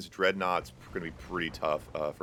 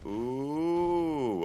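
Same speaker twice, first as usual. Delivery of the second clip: surprised ooh; 7 semitones higher than usual. Real, not acted. Caster reacts to a snipe.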